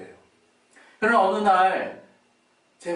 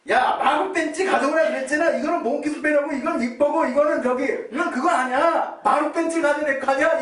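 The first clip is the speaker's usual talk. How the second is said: high, loud exasperated father's scolding; mock-angry father's loud rebuke; 11 semitones higher, 5 dB louder than usual. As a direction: scold him like an impatient dad who got the wrong wrench. Father scolding son over wrong tool.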